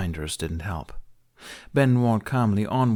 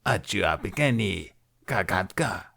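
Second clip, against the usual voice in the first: Hutt voice. Acted. Hutt speaks Huttese.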